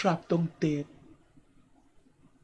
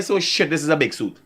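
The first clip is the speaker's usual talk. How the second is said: ordinary talk, nothing marked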